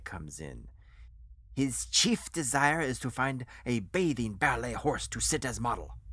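put-on measured speech in a foreign accent; accented foreign financier, explaining. Financier explains the chef's artistic desire, accented and grand. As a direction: lay out the plan in a heavy accent. Voices Rolf explaining his plan.

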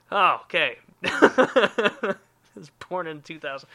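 slow, laughing "I was born in two thousand"; amused laughing confession; laughing admission. Real, not acted. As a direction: giggle through the confession. Laughs at own birth year.

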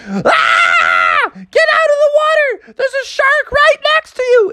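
very high, crying-out alarm; panicked scream. Acted. Parents to children near a shark, screamed warning. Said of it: "they screamed".

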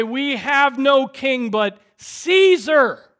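high, loud, drawn-out proclamation; crowd's declaration. Acted. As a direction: preach it out slowly at full volume.

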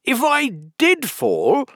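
high, loud, self-important voice; pompous and emphatic, pitched high; Humpty Dumpty. A narrator's reading. Humpty imagines falling off the wall, pompous. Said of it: "he went on".

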